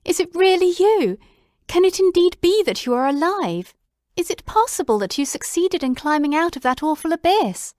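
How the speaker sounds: high, loud, breathless disbelief; crying out in amazement. Acted. Watson crying out at Holmes alive again, high and loud. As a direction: pour out the questions, astonished. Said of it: "I cried".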